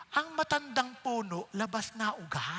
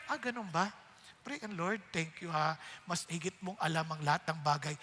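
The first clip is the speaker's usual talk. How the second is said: soft, lower prayerful voice; calm prayer; 6 semitones lower, 4 dB quieter than usual. Acted. Believer praying quietly to the Lord instead, soft and calm. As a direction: pray quietly, surrendering it all.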